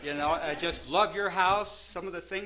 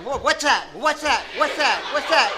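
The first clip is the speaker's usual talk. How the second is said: jittery paranoid panic; 6 semitones higher, 10 dB louder than usual. Retold or imitated. Paranoid on speed, frightened.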